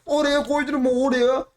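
high, drawn-out protest; exasperated outburst. Real, not acted. Player protesting at the game's obstacle, exasperated and high.

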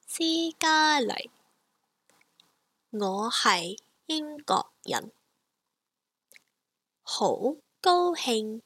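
slow, careful Cantonese demo; drawn-out teacher model; slow model phrase. Shown as a demonstration. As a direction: Cantonese spoken slowly for learners. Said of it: "So slowly".

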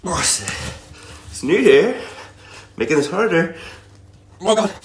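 strained, effortful voice; doing push ups. Real, not acted. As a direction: push the words out mid-rep. Straining mid push-up set.

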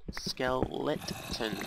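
Skeleton drawn out slowly with a held t; slowly stretched Skeleton. Shown as a demonstration. Player to herself, playing with the word Skeleton, slowly stretched. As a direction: stretch out Skeleton, savoring every sound. Repeats word, checks pronunciation.

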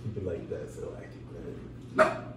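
unvoiced whisper, slow. Real, not acted. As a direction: whisper so nobody hears. Hiding in ambush.

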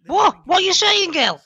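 loud high outraged squeal. Acted. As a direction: shriek it in disbelief. Dealer outraged at complaint.

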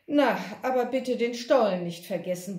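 plain reading voice

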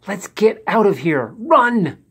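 high urgent call to run. A narrator's reading. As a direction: hurry them out. Rescuers urging escape.

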